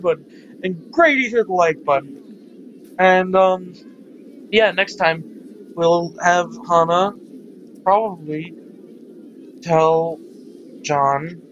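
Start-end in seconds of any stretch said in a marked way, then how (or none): none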